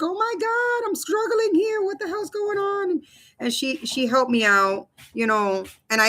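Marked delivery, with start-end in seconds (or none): 0.0-3.0 high, squeaky mock panic; retold meltdown, pitched way up; imitating panicking. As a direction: replay the freakout in a much higher voice. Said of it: "I was, like, freaking out".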